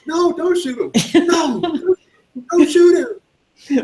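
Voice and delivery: high, slow squeaky plea; hammed-up panicky bystander; pleading crowd member. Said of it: "somebody goes".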